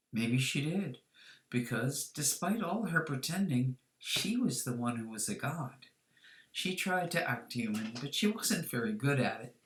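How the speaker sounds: plain reading voice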